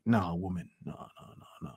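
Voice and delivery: low, hushed no's fading to nothing; mock refusal, dying away under the breath; dismissive refusal. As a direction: imitate a man quietly backing away. Acting man declining single mother.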